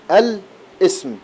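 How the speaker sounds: الاسم drawn out very slowly, syllable by syllable; slow demonstration of الاسم; slow sounding out. Shown as a demonstration. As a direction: spell out الاسم slowly for the student. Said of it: "ب-، ال-، الاسم".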